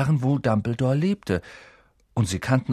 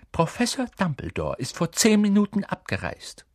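high-pitched, cool formal reply; 10 semitones higher than usual. A narrator's reading. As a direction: inform them coolly. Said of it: "sagte sie kühl".

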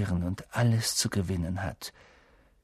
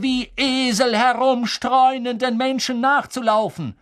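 furious outburst; 13 semitones higher, 8 dB louder than usual. A narrator's reading. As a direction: spit out each word in scorn. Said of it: "schlug wütend".

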